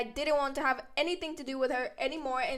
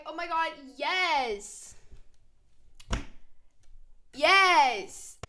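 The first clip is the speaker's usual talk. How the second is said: thrilled yes; 3 semitones higher, 5 dB louder, much slower than usual. Retold or imitated. Thrilled accepting party invite.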